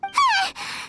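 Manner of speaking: high, nervous little laugh. Acted. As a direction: laugh it off in a fright. Scolded, nervous reaction.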